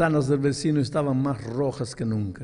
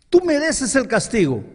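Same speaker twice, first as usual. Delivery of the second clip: emphatic declaration; 10 semitones higher, 8 dB louder than usual. Retold or imitated. Mother declares punishment.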